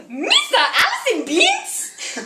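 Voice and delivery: badly faked Jar Jar Binks impression. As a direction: do a bad Jar Jar through giggles. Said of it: "I decided to talk like Jar Jar Binks".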